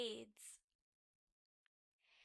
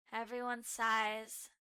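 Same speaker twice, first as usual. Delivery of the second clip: ordinary delivery, nothing marked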